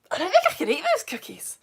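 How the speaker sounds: high-pitched recoil from the cookies; strong squeamish reaction. Real, not acted. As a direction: squeal in half-laughing revulsion. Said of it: "I'm having a strong reaction".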